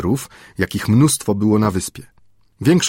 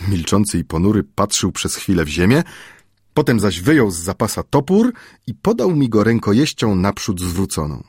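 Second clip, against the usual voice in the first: usual speaking voice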